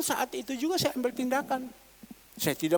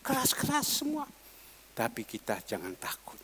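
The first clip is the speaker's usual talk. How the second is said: ordinary talk, nothing marked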